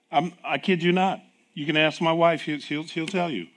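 ordinary talk, nothing marked

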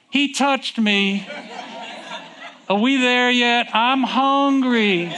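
put-on high, slow, whiny child voice; whining kids. Preacher imitating kids whining in the car, high and drawn out. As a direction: drag the words out like a bored kid in the back seat.

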